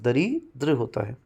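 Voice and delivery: normal talking voice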